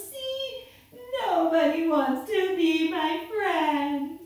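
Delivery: drawn-out, loud mock wail; crying snake. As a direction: whine it slowly as a sobbing snake. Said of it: "started crying even louder".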